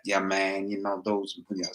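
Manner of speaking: put-on soft, half-hearted Australian accent; quiet, badly faked Aussie accent. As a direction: trail off into a poor Australian accent. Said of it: "Australian accent ever".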